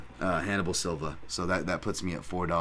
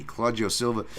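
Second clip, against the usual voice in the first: ordinary delivery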